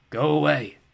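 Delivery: ordinary delivery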